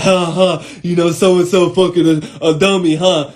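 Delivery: mimicking a goofy, jeering gossip; jeering gossiper. Gossiper inviting him to laugh at someone, mocking. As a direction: imitate someone snickering about another person.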